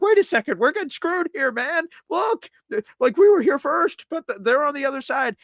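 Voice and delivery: high-pitched mock outrage; retold squealing grievance; outraged complaint. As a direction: plead the case, voice shot up. Acted complaint about being skipped.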